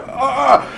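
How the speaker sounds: high, re-enacted cry of panic; short acted "ah" of alarm; mock scream. Imitating terror running through debris.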